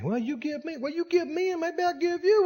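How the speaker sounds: high, soft mock pleading; bargaining spouse. Mimicking spouses bargaining for love.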